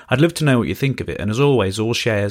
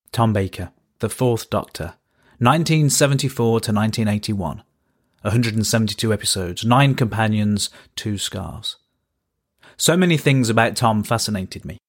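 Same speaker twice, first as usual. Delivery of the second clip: ordinary delivery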